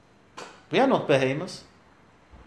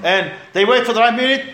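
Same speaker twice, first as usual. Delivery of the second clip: usual speaking voice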